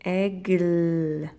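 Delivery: "Aigle" stretched, with a long held final l; sound-by-sound word. Shown as a demonstration. Teacher giving pupils a word to split, elongated "Aigle". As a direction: draw out the sounds of "Aigle", lingering on l. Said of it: "chaque son que tu entends".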